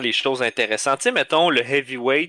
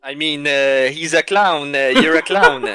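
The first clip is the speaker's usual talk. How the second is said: Georges Saint-Pierre impression; 2 semitones higher, 6 dB louder, slower than usual. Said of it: "Vos imitations sont on point".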